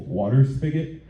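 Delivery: usual speaking voice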